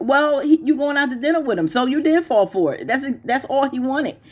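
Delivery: exasperated lecture. Real, not acted.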